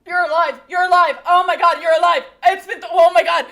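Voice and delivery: loud, high astonished cries; shocked joy. Acted. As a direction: yell it over and over in disbelief. Character finds dead friend alive.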